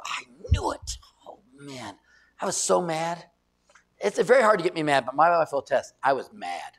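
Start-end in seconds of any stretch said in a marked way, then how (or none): 0.0-2.0 whispered, under the breath I knew it; muttered dismay. Retold or imitated. Pastor hearing which lady it was, muttered under his breath. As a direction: groan it quietly to yourself. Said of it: "I go".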